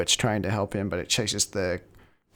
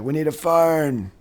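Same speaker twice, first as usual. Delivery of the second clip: high-pitched mock urgency; acted desperate demand, pitched up; urgent retold call; 5 semitones higher, 3 dB louder than usual. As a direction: call out as if under fire. Voicing soldiers desperate under fire.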